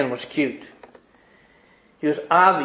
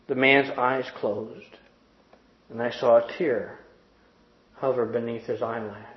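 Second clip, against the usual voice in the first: tender; 6 dB quieter than usual. A narrator's reading. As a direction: hush down, let the moment land. Tender hug moment narrated.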